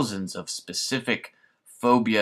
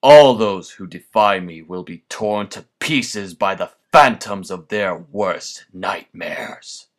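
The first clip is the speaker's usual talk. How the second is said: villainous rant; 8 dB louder than usual. Acted. Villain rant builds to triumph.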